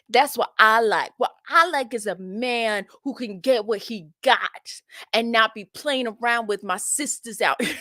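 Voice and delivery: sassy, emphatic put-on woman; mock passive-aggressive. Streamer translating contestant's answer about her sisters, mock passive-aggressive. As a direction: act out her pointed dig, hammed-up. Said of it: "That was her being passive aggressive".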